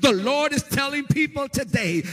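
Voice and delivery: loud, sing-song revival preaching; full-force preaching. Acted. Preacher rousing congregation about divine need, loud sing-song. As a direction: ride the cadence like a revival preacher.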